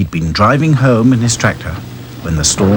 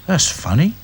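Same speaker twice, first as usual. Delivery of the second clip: higher, puzzled doll voice; musing doll; 8 semitones higher, 4 dB quieter than usual. Acted. Doll puzzling over empty barn, musing. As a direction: wonder to yourself. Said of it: "he thought".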